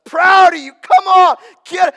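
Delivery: big booming cheer, high and loud. Pretend. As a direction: shout encouragement like a proud dad. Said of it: "I think God's up there going".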